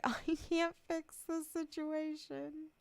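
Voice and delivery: high, slow mock-tearful wail; hammed-up weepy helplessness; mock crying. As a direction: imitate yourself helplessly crying, drawn out. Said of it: "I was, like, hard crying".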